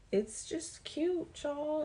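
slow, high cooing "cute", stretched out; a drawn-out high gush on "cute". Real, not acted. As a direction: coo over how cute it is. Gushing over a cute dress.

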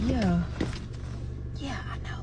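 hushed whisper into the phone. Acted. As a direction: whisper so he can't hear. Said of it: "Why, why you whispering?".

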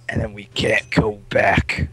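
slow, drawn-out story ending; dramatic slowdown. Real, not acted. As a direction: stretch each word for drama. Dramatic telling of game story.

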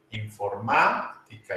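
"Informática" slowly, leaning on the "má"; stress on the accented A. Shown as a demonstration. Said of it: "lleva acento en la A". (acento marcado en la á de "Informática")

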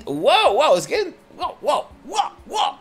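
surprised whoas. Real, not acted. Gamer overwhelmed by a rush of orders, startled whoas. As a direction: caught off guard, gasping whoa. Game suddenly speeds up.

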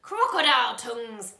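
loud wild exclamation; old man waving his stick, crying out wildly. Acted. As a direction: fling it out madly. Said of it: "he cried".

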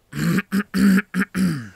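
loud throat clear. Real, not acted. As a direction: clear your throat hard. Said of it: "Голос у меня что-то подсел". (громко прочищает горло)

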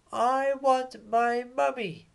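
very high, small voice wailing for mummy; whiny baby owl. Acted. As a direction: play a tiny owlet who misses his mum. Baby owl Bill cries for mum.

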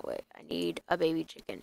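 ordinary talk

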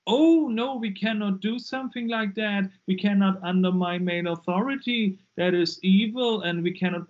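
mock shocked protest, starting high and loud; indignant protest. Woman piously refusing before the sisters, mock indignant. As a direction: imitate a scandalised refusal, high then settling.

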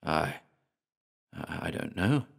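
soft, hesitant, frightened reply; barely daring to answer; tremulous. A narrator's reading.

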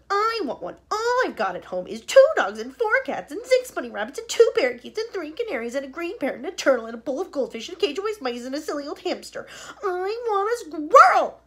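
high, loud whining rant; a spoiled girl listing her pets in a fit; tantrum. Acted. Veruca's tantrum list.

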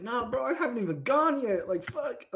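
high-pitched defensive denial; mock exasperated protest. Imagined guy wrongly blamed for the mess, high exasperated denial. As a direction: whine that you haven't even gone yet.

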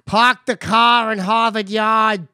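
put-on slow, broad Boston accent. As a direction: drawl it out like a Bostonian. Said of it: "Boston accent".

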